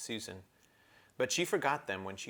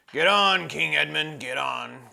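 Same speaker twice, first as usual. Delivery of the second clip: loud, higher dwarf calling; calling from above; 6 semitones higher, 12 dB louder than usual. Acted. Trumpkin calling down to Edmund, loud impatient shout. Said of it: "came Trumpkin's voice from behind".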